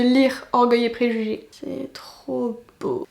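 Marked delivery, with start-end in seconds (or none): 1.5-3.1 slow, awed whisper. Real, not acted. Vlogger admiring the card, slow hushed whisper.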